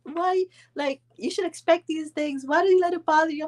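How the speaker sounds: a hint of the calm husband, up high; husband's voice. Retelling how her husband calmed her, lightly voiced. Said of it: "He's like".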